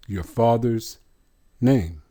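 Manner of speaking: ordinary talk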